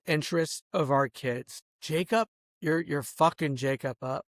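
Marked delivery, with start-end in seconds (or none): none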